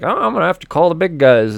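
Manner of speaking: a hammed-up cafeteria lady calling for backup; lunch lady voice.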